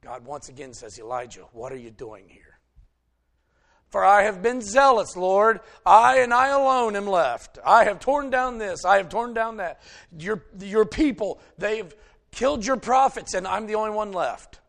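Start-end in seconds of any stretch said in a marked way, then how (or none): 1.0-2.6 softer, lower gentle asking; God asking Elijah gently; soft question. Retold or imitated. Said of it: "a voice, still and small". 3.9-14.6 loud, higher, insistent pleading; loud complaint. Retold or imitated. Elijah's repeated complaint to God.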